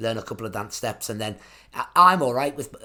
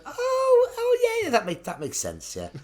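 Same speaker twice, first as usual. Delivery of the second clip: mock realisation. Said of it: "little things going".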